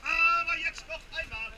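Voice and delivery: full-voice market crier shout; fish market auction. Real, not acted. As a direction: bellow it across the market.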